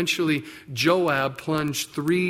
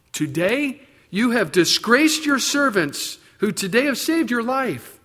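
stern rebuke; 5 semitones higher, 5 dB louder than usual. Retold or imitated. Joab rebukes the king.

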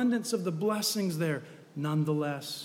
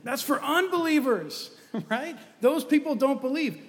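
high-pitched mock dismissiveness; smug dismissal; 8 semitones higher, 5 dB louder than usual. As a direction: wave it off as other people's problem. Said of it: "And again, we think".

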